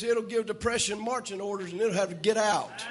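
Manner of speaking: ordinary talk, nothing marked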